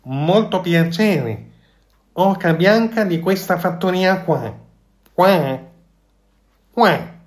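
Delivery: high, slow goose voice ending in quacks; goose tries a grand greeting. A narrator's reading. The goose copies the fox's greeting, high and honking. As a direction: clear your throat and try to sound important. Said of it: "schiarendosi la voce provò".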